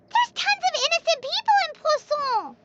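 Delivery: high-pitched indignant outburst; horrified protest for the innocent; outraged. Acted. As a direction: cry of disbelief. Outraged at the plot.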